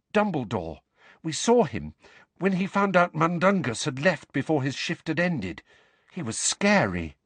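high-voiced girl in awe; awestruck. A narrator's reading. Hermione describing how Dumbledore reacted, awestruck. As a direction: recount it with hushed amazement. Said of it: "in an almost awestruck voice".

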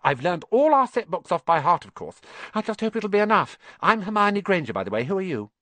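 put-on high, rattling bossy-girl chatter; very fast. Hermione introducing herself to the boys, very fast. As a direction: gabble on eagerly, barely pausing. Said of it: "She said all this very fast".

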